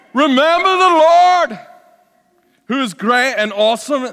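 high-pitched, bellowed Scottish war cry; best Scottish accent. Acted. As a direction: roar it out like a Scottish rebel leader. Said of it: "my best Scottish accent".

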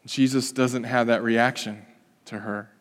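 usual speaking voice

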